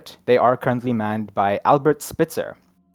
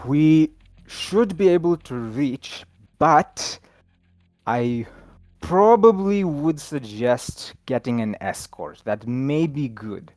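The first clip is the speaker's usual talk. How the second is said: Al the sailor; 4 semitones higher, 4 dB louder, much slower than usual. Acted. GM voices sailor Al.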